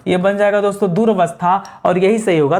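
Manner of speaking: usual speaking voice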